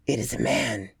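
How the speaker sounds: lightly voiced low ape man gabbling; gabbled. A narrator's reading. Ape man guide repeating to the creature, soft low gabble. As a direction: gabble it softly. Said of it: "gabbled my conductor".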